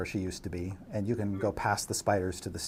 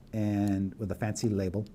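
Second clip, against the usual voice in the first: ordinary talk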